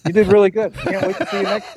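breezy team owner congratulating the new player; imitating Jerry Buss. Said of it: "There's Jerry Butts going".

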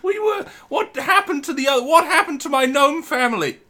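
high, loud, desperate plea with laughter; pleading shout. Acted. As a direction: beg your old friend, laughing. Player pleads with friend.

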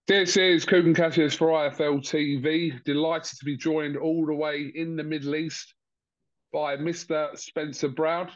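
normal talking voice

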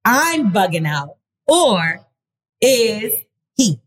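slow, drawn-out question with pauses; emphatic reading. Acted. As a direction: put the question to listeners word by word. Reads the letter's closing question.

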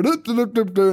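high doop-doop strolling noise; light, bouncy little doops; carefree humming. Retold or imitated. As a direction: imitate a carefree walk down the hall. Nurse strolling obliviously down hallway.

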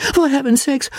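high, fretful gentleman's plea; a nervous aesthete begging calm; fussy pleading. Acted. Fussy host pleading with angry guest, high voice.

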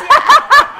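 big high burst of laughter; loud laugh. Real, not acted.

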